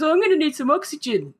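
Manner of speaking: mock her like she's an idiot; mocking quote. Mocking the woman's request.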